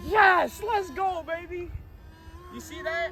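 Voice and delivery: high-pitched triumphant cry; elated cheering. Real, not acted. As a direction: celebrate as it finally takes off. Plane finally flies.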